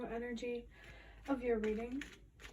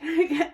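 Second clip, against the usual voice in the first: laugh; 6 semitones higher, 12 dB louder than usual. Real, not acted. Laughs at seeing number again.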